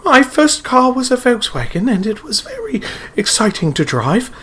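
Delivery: imitating an American putting on a British accent; exaggerated put-on British accent, high; Gillian Anderson British accent. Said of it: "But then she goes on Top Gear".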